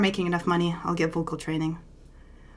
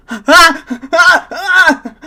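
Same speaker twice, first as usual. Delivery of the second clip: mock panic; 11 semitones higher, 14 dB louder, much slower than usual. Panics at thought of audience.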